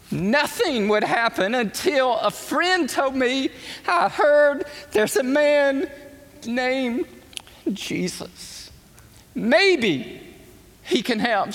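high, crying voice breaking on the words; real emotion cracking through; choked up. Real, not acted. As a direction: fight back real tears as you tell it. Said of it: "I always get emotional telling this story".

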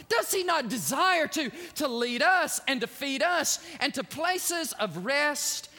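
high, loud preaching climb. Acted. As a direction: drive it home to the congregation. Preaching at full force.

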